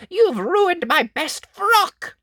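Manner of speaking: very high, slow, indignant; furious witch. Acted. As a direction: wail your ruined frock.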